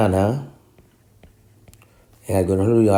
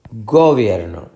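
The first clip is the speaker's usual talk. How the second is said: Gobierno stressed on its first syllable, GO; misplaced stress. Shown as a demonstration. Teacher testing syllables aloud, wrongly stressing GO in Gobierno. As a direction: hit GO in Gobierno, then fall away. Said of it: "Esta es la sílaba tónica".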